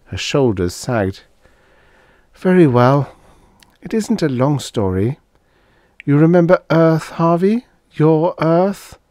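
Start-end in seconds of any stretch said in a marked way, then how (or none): 2.3-9.0 high woman's voice, resigned then probing; Dana resigned. Acted. Dana beginning her story to Harvey, resigned. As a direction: give in and begin to explain.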